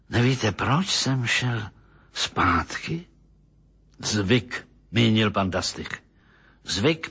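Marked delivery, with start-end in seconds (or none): none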